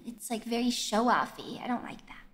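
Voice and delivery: low, soft, sheepish grumble; quiet reluctance. Real, not acted. Sheepish complaint after singing properly.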